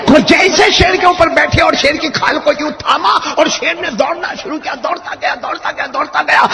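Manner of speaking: high, loud excited preaching; high, loud preaching at the climax; climactic preaching. A narrator's reading. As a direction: drive the climax home.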